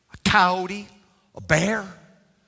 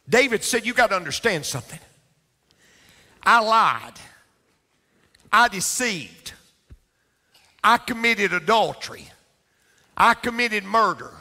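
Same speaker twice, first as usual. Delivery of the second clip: preaching at full force; 6 semitones higher than usual. Acted. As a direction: proclaim David's confession to the crowd. Preaching David's confession.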